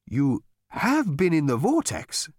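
slow, halting stammer in shock. Acted. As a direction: stumble over the words. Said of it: "stammered Gargravar".

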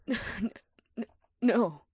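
gasping, stammering no; frightened whisper. A narrator's reading. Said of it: "the witch whispered".

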